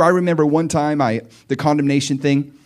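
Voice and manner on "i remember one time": ordinary talk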